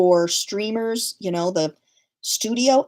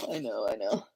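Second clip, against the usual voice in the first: breathy under-breath whisper; 12 semitones lower, 10 dB quieter than usual. Real, not acted. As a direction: mutter it to yourself, barely voiced. Mother sighing under breath.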